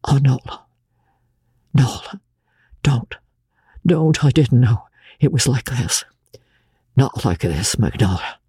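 anguished, whispery plea with halting pauses; voice breaking with agony. A narrator's reading. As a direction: plead brokenly at a bedside. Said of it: "in a voice that broke with agony".

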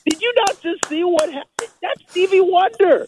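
high, stunned disbelief; squeaky astonished protest; incredulous shock. Retold or imitated. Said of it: "And I'm like".

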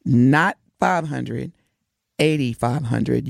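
low, slow, with long pauses between phrases; deliberately slow. Shown as a demonstration. Host making sure the brothers understand, slow and deliberate. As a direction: lay it out piece by piece so nobody misses it. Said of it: "I'ma say it slow".

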